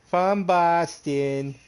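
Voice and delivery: slow, high mock Boston drawl of From Boston; teasing the idea he sounds like he's from Boston; mock Boston accent. Shown as a demonstration. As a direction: stretch out "From Boston" in a hammed-up Boston accent. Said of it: "I don't have a Boston accent".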